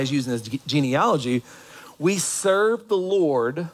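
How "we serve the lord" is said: slow emphasis. Real, not acted. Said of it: "slowed for emphasis".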